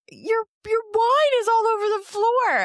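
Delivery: high, loud and slow, dragging out the alarm; high drawn-out horrified wail; friend's horrified report. Retold or imitated. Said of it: "She's like".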